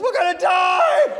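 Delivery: high, loud, slow terrified yelling; panicked shout. Retold or imitated. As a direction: scream it over the hurricane wind. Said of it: "She goes".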